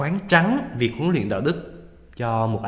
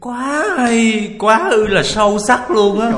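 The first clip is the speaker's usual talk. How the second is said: high, drawn-out, hammed-up awe; wide-mouthed amazement; 8 semitones higher, 4 dB louder, much slower than usual. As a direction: gush as if floored by such depth. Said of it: "miệng chữ O, mắt chữ A".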